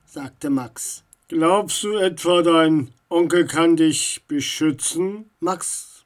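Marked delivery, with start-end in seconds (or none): 1.3-5.3 loud, menacing voice from above; foreboding question from an unseen figure; ominous taunt. A narrator's reading. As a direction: challenge the intruder with weight. Unknown voice from above taunts Max.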